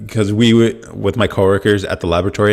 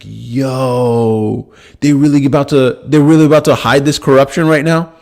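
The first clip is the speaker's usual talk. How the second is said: higher, louder outraged quote; outraged disbelief; 4 semitones higher, 3 dB louder than usual. Retold or imitated. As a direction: ask it as if you can't believe your ears. Retold outrage at Comey.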